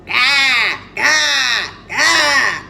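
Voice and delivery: loud, harsh bird squawks; three loud peacock cries; hideous peacock shrieks. Acted. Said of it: "they all shrieked in the hideous voice that the peacock has".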